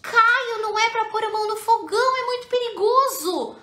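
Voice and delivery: mock exasperated mother scolding Caio. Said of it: "se eu tivesse esse olhar de".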